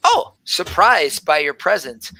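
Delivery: ordinary talk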